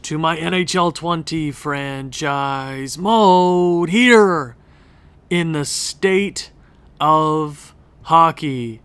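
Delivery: slow, drawn-out hype announcer welcome; announcer intro. Acted. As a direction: greet the crowd like an arena announcer, stretching it out. Hockey video intro greeting.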